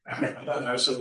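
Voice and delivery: ordinary delivery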